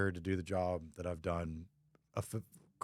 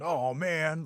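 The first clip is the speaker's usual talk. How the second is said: pouty groan; 7 semitones higher, 3 dB louder than usual. Retold or imitated. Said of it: "Get all pouty".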